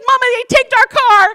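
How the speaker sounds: put-on high, loud child's cry; her young son. Son at the window telling mama the car is gone, a child's loud cry. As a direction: voice her little boy, upset. Son voiced in retold story.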